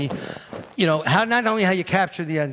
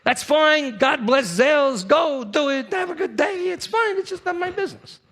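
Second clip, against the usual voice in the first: mock dismissive; 11 semitones higher, 4 dB louder than usual. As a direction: sarcastically wave them off. Sarcastic dismissal of rival sellers.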